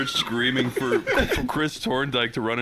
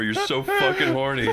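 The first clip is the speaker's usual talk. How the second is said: usual speaking voice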